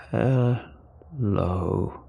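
slightly gravelly alien, drawn out; lightly voiced gravelly alien greeting; a slow hint of low alien gravel. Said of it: "said in a low, gravelly voice".

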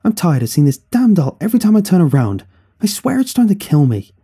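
high, loud exasperated outburst; fed-up rant. A narrator's reading. Son to mother, sick of the doll, angry rant. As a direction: vent it with pitch right up.

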